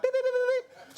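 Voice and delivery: high steady beeping; beeping sound. Retold or imitated. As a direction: beep like an alarm. Imitating buzzer.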